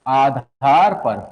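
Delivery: drawn out slowly, low pitch; slow dictation. Real, not acted. Teacher dictating a heading to students, slow dictation. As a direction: stretch the words for note-takers.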